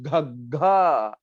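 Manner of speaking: घग्घा said slowly, pressure from the chest; forceful gh sound. Shown as a demonstration. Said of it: "कैसे बोलेंगे इसको?". (घग्घा को धीरे, छाती के दबाव से बोलो)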